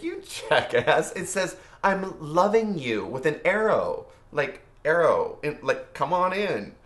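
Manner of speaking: high-pitched, drawn-out mock scolding; retold exasperation. Explaining a misread note to a partner, mock exasperated. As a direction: spell it out slowly, imitating an exasperated partner.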